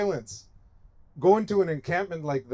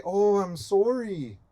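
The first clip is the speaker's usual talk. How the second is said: higher, drawn-out mock Canadian apology; Canadian accent; 5 semitones higher, slower than usual. Canada saying sorry to Native people, mock Canadian accent. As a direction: put on a slow Canadian accent. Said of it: "That's my Canadian accent".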